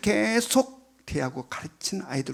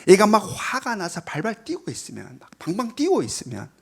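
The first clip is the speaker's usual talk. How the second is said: ordinary talk, nothing marked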